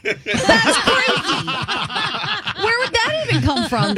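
drawn-out laughing "That's crazy"; laughing disbelief. Real, not acted. Cohost laughing at Lion King accents, drawn-out laughing disbelief. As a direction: laugh through the words in disbelief.

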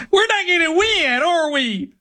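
very high, mock-whimpering worry; fretful fan. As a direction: imitate a nervous spectator in falsetto. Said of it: "you end up with people in the suite".

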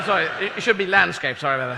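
ordinary delivery

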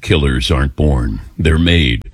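deep movie trailer announcer; dramatic trailer voiceover, low and weighty. Shown as a demonstration. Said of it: "I gotta do this in my announcer voice".

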